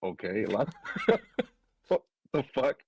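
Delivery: laughing disbelief. Retold or imitated. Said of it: "I was like".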